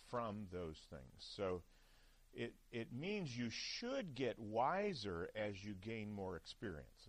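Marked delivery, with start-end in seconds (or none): 3.3-5.3 "should" leaned on and stretched; slow down and emphasize "should"; stress on SHOULD. Real, not acted. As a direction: deliberately punch the word "should". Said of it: "deliberately emphasize the word should".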